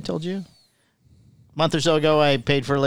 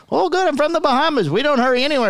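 high-pitched island lady voice; light Bahamian accent, easygoing; 8 semitones higher than usual. Retold or imitated. Lady at grocery store door telling him, high Bahamian lilt. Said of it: "She goes".

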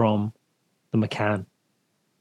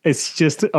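ordinary delivery

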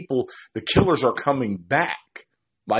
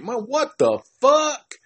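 much higher, squeaky mock disbelief; stunned disbelief; 13 semitones higher than usual. Viewer reacting as the killer is unmasked, squeaky stunned disbelief. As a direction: squeal it like you can't believe your eyes. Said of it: "And I'm like".

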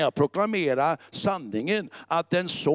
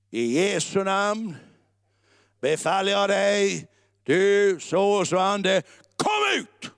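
slow, raised command exploding into a high final cry; commanding exorcism; 3 semitones higher, slower than usual. Shown as a demonstration. Preacher showing how he commands demons once, slow then sharply cried out. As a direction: build deliberately to one piercing order. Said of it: "Om jag ska befalla demoner".

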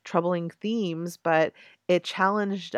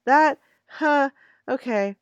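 higher, louder bemused pause; hesitate, then give in; baffled acceptance; 8 semitones higher, 6 dB louder, slower than usual. Retold or imitated. Reacting to the Bible story, higher and louder in bemused resignation. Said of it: "And I'm just like".

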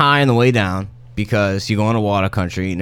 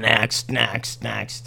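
half-hearted, toneless calling-out that trails off softer; inattentive teenager; 7 dB quieter, much slower than usual. Acted. Game master voicing a distracted slide attendant, inattentive. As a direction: call the queue through without paying attention. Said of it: "some dickhead teenager on his fucking cell phone. He's not paying attention".

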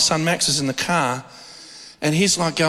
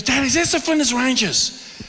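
put-on higher, eager child's question; excited little son; 7 semitones higher than usual. Father voicing his eager son asking if they've arrived. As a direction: play the boy bursting to know.

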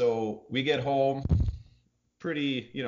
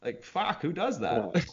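high, mock-indignant squeak; mock-outraged, high and light; mock outrage; 8 semitones higher, slower than usual. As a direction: play at being outraged.

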